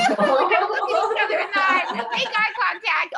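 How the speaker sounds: loud, high, laughing speech; laughing through it. Real, not acted. Leader cracking up while giving the next step.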